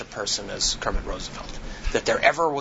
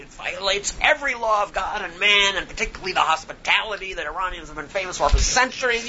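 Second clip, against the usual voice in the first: retold angry shouting; 8 semitones higher than usual. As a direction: pour out a year of built-up frustration, retold. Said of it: "I started shouting".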